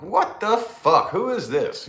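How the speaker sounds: high, slow, alarmed outburst; startled. Retold or imitated. Startled driver hearing a knock on his car window. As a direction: caught off guard by a knock, react.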